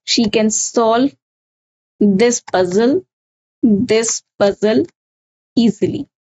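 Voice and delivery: slow, word by word, repeating each chunk; slow dictation. Shown as a demonstration. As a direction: dictate it slowly for the class to write down. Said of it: "write the sentence like".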